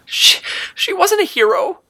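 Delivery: put-on very high, stammering child voice; crying girl. A narrator's reading. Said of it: "the girl cried".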